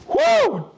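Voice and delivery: high whooping cheer; excited woo. Real, not acted. Host cheering a viewer's home state, high excited woo. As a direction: let out a happy high woo.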